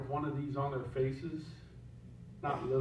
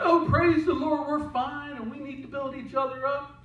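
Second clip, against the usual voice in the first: fake cheerful churchgoer; 7 semitones higher than usual. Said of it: "Putting on a show".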